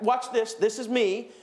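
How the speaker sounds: ordinary talk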